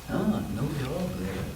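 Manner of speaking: plain reading voice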